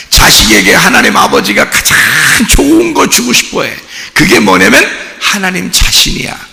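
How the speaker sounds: loud, with the voice leaping high on the key phrase; impassioned preaching. Acted. Pastor telling the church what God wants to give, with fervor. Preaching climax on God's gift.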